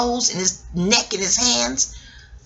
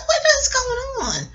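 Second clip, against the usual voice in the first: much higher, slow cry of what is going on; disbelief; 4 dB quieter than usual. Real, not acted. Speaker reacts to self-promotion, much higher, slow. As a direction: cry it out, stunned.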